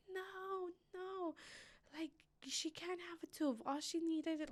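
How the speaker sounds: begging it isn't so; frantic pleading. Retold or imitated. Mother screaming at the hospital girl, high and desperate. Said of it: "screaming at the girl".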